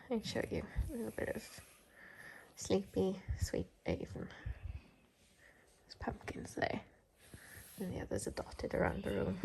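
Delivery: hushed whisper by sleeping dogs. Real, not acted.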